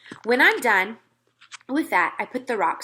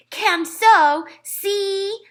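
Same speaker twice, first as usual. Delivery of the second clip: put-on higher, much louder stubborn toddler; little Fudge insisting; 12 semitones higher, 8 dB louder, much slower than usual. A narrator's reading. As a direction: shout the boast like a small boy. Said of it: "Fudge opened his mouth".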